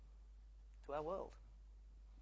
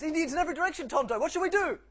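panicked high-pitched shout, very loud; Lone Ranger; 13 semitones higher, 13 dB louder, faster than usual. Acted. Surrounded cowboy hero begs his companion for orders, mock-panicked shout. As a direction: cry out in hammed-up desperation. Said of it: "the Lone Ranger goes".

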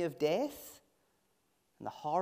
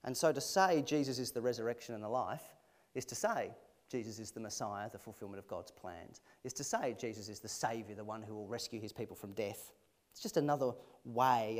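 ordinary talk, nothing marked

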